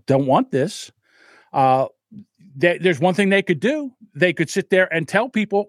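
ordinary talk

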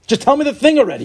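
high, loud mock impatience; whiny demand to get to the point; impatient listener.

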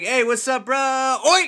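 imitating a fake Australian accent shout; mock Australian switch. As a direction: flip from bro talk to fake Australian oi. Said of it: "he's like".

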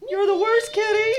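high cooing pet voice. Real, not acted. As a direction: coo at the dog, teasing. Cooing at the dog.

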